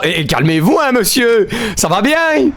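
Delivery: scolding that climbs very high; scandalised squeak, played for fun; mock indignation. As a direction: tell them off in a high, hammed-up voice. Mock scolds the game's character.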